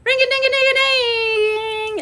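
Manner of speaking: high, slow singsong bell ringing; bell imitation. Streamer rings a pretend bell for viewers, singsong. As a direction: ring it out like a little bell. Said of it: "Y'all need the bell?".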